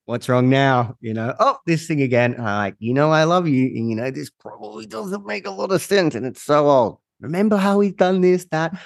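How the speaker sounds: high, mocking teasing voice; sarcastic, high-pitched teasing of his inner critic. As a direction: tease him with exaggerated fake surprise. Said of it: "I am a bit sarcastic with him".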